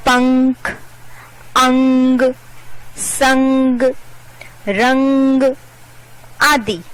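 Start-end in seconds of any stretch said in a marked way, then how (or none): 0.1-5.4 पंख, अंग, संग, रंग, each ringing on a level nasal "ng"; nasal anusvara demo. Shown as a demonstration. Teacher listing dotted words for children, nasal hum held in पंख, अंग, संग, रंग. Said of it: "नाक से ध्वनि निकलती है".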